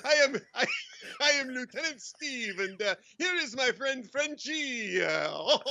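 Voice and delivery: slow, hammed-up French accent; best French accent. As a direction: introduce yourself as a comic Frenchman. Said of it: "put on your best French accent".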